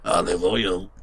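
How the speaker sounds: a light cartoon-sailor Popeye voice; Popeye impression. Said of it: "that's my Popeye impression".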